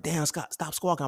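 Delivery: lightly imitated high-pitched jeer; mock heckling. Siblings telling him to stop squawking, mock heckling.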